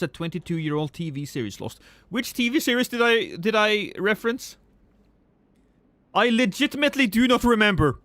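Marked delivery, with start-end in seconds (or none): 2.1-4.6 high, incredulous question; genuinely lost, asking who said what; baffled. Real, not acted. Streamer puzzling over a chat comment, baffled. 6.1-8.0 louder and higher, exasperated insistence; emphatic. Real, not acted. Streamer protesting to chat, emphatic.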